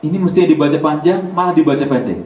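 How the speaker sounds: ordinary delivery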